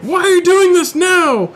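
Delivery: high-pitched comic screech; mock scream. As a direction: scream it as the protesting shoulder, hammed up. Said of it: "my shoulder just screams at me like".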